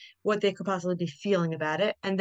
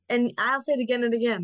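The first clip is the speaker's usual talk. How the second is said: usual speaking voice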